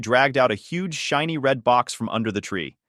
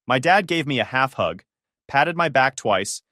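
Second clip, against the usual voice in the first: ordinary delivery, nothing marked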